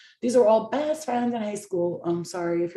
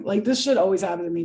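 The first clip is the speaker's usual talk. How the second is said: ordinary talk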